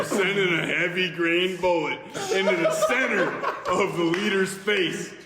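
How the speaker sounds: ordinary talk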